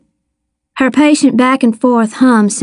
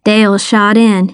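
plain reading voice

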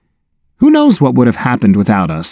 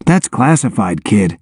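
low, dry and gruff; deadpan tough guy; 4 semitones lower than usual. A narrator's reading. Soldier deflecting with gruff joke.

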